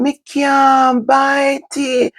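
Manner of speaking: high, very slow whining cry; whiny child. Retold or imitated. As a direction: wail it out like a little girl. Child crying out she can't bite.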